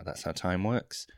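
ordinary talk

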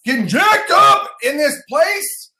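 high, loud, stretched-out hype yell; hyped-up shout. Real, not acted. Host getting jacked up with the chair, hyped-up shout. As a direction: whoop it up for the crowd. Hyping up after raising the chair.

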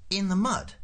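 high-pitched dismayed question; incredulous official. A narrator's reading. Prosser learns he must lie in mud, high disbelief. Prosser queries Ford's absurd request.

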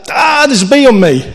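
high, loud panicked shriek, hammed up; mock panic. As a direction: squeal it like a bee just landed on you. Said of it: "And usually I'd be like".

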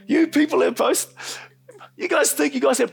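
high, loud mock tirade; imitated angry yelling. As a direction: yell like the furious office man. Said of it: "he's yelling at the top of his voice".

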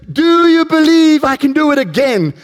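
high, projected call to a crowd; rally the audience like a stunt performer; showman's call. Acted. Acted showman calling to crowd.

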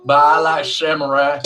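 slow, drawling mock-Southern accent; Mary impression. Persisting with the co-host impression, botched Southern accent. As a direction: stretch it out in a bad American accent. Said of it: "from the South?".

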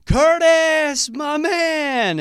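high, loud holler with drawn-out words; big imitated friendly yell; exuberant shout. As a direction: call out to him across the room, loud and high. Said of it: "then Gale being Gale, he goes".

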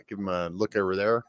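usual speaking voice

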